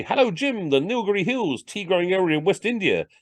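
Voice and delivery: high-pitched show-off greeting; high voice parading posh knowledge; imagined posh chat. Acted. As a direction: drop it airily into party talk, pitched high. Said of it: "This is what posh people knew".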